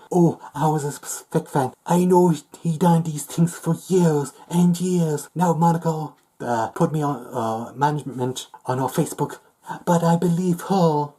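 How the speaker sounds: loud, high-pitched parody quote; mocking former fan.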